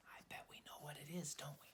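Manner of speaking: put-on secret whisper to the kids, quick. A narrator's reading. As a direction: lean in and whisper it fast. Narrator aside to young listeners.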